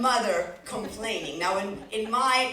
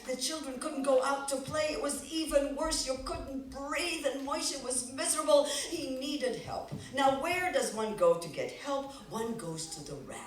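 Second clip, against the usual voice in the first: usual speaking voice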